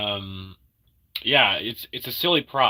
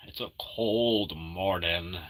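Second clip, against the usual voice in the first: slow, low, softer drawn voice; 5 semitones lower, 6 dB quieter, slower than usual. Pretend.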